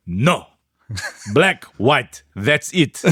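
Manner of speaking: put-on slow, blunt Greek vendor accent; mock Greek accent, each word set apart; grizzled Greek man. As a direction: refuse like an old Greek stallholder. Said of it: "this grizzled Greek man".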